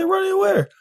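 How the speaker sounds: high disbelieving question; incredulous. Retold or imitated. Telling his boss he won't go in, high incredulous. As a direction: ask it like she's crazy. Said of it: "I said".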